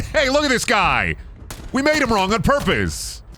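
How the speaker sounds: high mock voice of a factory worker; lightly voiced gleeful factory worker. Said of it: "they said".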